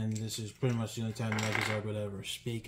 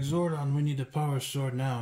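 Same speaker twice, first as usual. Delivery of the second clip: Jason; 4 semitones higher, 6 dB louder than usual. Retold or imitated. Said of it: "normally Jason would be like".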